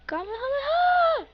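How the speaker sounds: high-pitched battle cry; attack shout. Acted. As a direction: call the attack name out high. Calling out special attack.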